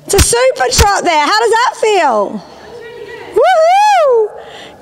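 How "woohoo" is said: high, delighted whoop; cheering whoop. Real, not acted. Instructor cheers the rider's good trot, a high delighted whoop. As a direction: whoop her on with joy.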